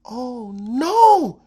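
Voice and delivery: lion screams that the sky is falling. A narrator's reading. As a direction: scream in terror. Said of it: "screamed the lion".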